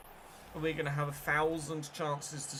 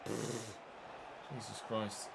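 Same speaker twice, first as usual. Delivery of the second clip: very slow, much softer and lower; exasperated groan; 6 semitones lower, 11 dB quieter, much slower than usual. Real, not acted. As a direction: drag it out in despair. Exasperated at a bad pass.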